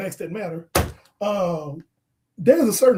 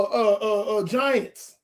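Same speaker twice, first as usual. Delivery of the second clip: normal talking voice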